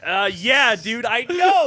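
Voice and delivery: loud, high burst of agreement; excited agreement. Real, not acted. Agreeing with friend about Superman, loud excited outburst. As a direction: cry out in total agreement.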